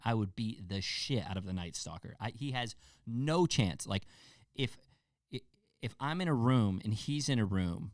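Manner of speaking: ordinary talk, nothing marked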